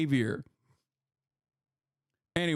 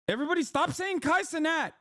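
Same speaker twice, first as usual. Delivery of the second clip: mock boast; 6 semitones higher than usual. Mock self-aggrandising.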